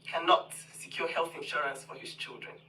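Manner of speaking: ordinary talk, nothing marked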